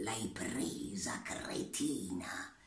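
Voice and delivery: low, slow whispered sneer; scornful whisper. Acted. Butterfly mocking Teresa from her hand, whispered low and slow. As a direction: hiss it at her with contempt. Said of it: "gridò Farfallina".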